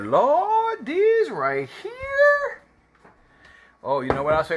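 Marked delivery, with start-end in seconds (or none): 0.0-2.6 much higher, slow ecstatic wail; real food excitement, crying out high; excited squeal. Real, not acted. Overjoyed at the food.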